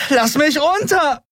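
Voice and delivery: high, drawn-out furious scream; outraged high yell; screamed protest. A narrator's reading. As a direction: scream while struggling to get free. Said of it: "schrie sie und trommelte mit den Fäusten".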